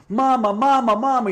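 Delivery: high, loud mock scream of mama; frantic comic yelling, retelling a joke. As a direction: yell for mama at the top of your lungs. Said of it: "He's screaming at the top of his lungs".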